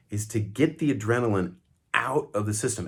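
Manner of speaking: normal talking voice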